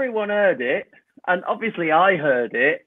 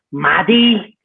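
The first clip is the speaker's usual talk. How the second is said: imitated child's voice; 2 semitones higher, 5 dB louder than usual. Third kid answers panto host, imitated child voice. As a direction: give your name as a kid would. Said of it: "like that".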